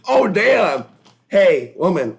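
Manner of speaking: loud, high mock alarm; acted startled protest. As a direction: call out in hammed-up shock. Acted confrontation with the friend.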